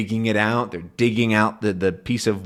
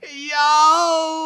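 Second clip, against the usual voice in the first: shriek. Acted. The other Thompson gets pinched, a high shriek. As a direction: shriek as you hop away.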